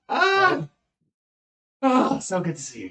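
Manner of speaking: high, loud delighted cry easing down; overjoyed squeal settling into fondness; gushing affection. Acted. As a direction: gush while hugging a dear friend. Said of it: "starts petting the guy and hugging him".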